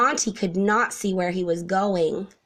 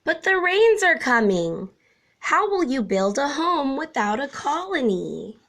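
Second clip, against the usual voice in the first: termite warning; 6 semitones higher than usual. A narrator's reading. Termites warn Monty.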